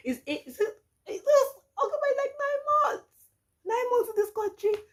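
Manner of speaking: tearful wail. Retold or imitated. Dismayed outburst retelling her despair.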